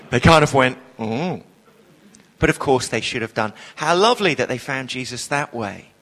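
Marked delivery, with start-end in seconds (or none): 1.0-1.4 small, low, soft oh; sheepish hesitation. Retold or imitated. Imitating people who regret raising hands, sheepish. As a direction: shrink back on a murmured oh.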